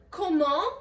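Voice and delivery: "comment" ending without any T sound; silent T. Shown as a demonstration. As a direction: leave the T off the end of "comment". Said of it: "we do not pronounce that T".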